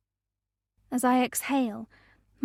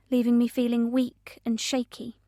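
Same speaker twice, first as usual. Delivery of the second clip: plain reading voice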